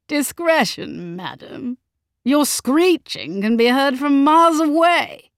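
exasperated, haughty husband; scornful rebuke. A narrator's reading. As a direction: throw her word back with contempt. Husband scornfully rebukes crying wife.

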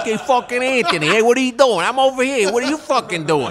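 high, loud mock Brooklyn mobster shout; hammed-up New York tough guy hollering; mob guy yelling. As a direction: bellow across the room like a wiseguy. Mob guys yelling at audition.

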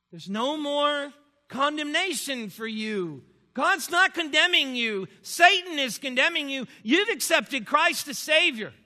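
rises to a loud, fervent plea; mentor's words, rising. Retold or imitated. Mentor Doctor Pennington reassuring the weeping student, rising fervent and loud. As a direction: builds from a slow start into firm loud assurance.

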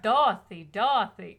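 put-on Tin Man in a Boston accent. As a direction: call out Dorothy in Boston accent. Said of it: "that's my go-to Boston accent".

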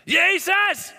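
very high, loud scream; desperate outcry. Acted. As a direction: cry out with everything you have. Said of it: "iemand roept het uit".

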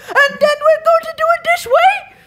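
mock angry Irish yell. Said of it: "like, yelling at a boardroom".

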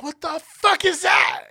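high, slow exclamation of shock; retold alarm. Kid suddenly feeling bites on his legs, alarmed. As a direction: stretch it out high, as if suddenly bitten.

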